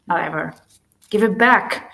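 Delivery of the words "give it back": loud, firm command; ordering. Acted. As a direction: order him to hand it over. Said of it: "she ordered".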